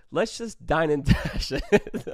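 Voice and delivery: words breaking into laughter; laughing. Real, not acted. Confessing the dine and dash plan, breaking into real laughter.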